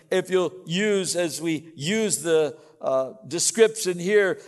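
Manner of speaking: loud, measured pulpit delivery; forceful preaching. Real, not acted. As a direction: proclaim it with full conviction to the congregation.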